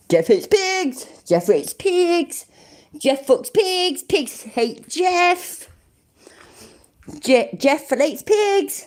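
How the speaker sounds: high, drawn-out mock impression; amateur impression. Shown as a demonstration. Streamer having a go at an impression, high and drawn out. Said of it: "not very good at doing impressions".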